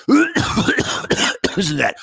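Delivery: raspy chesty cough voice; hoarse and congested, real sick; smoker's rasp. Real, not acted. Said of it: "I sound like I've been smoking cigarettes".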